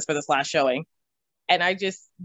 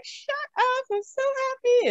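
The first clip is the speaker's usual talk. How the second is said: much higher, giddy squeal; overjoyed; slower than usual. Retold or imitated. Reacting to the realtor's good news, high giddy gush. Joy at getting the house.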